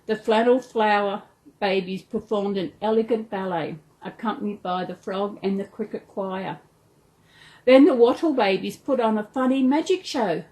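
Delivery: ordinary delivery, nothing marked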